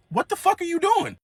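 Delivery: high, incredulous what-the-fuck; shocked outburst. Retold or imitated. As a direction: caught off guard and outraged. Grandson shocked at grandma.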